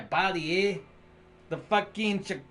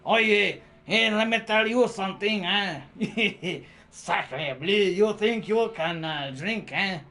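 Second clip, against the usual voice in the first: put-on French accent. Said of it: "the Frenchie comes out".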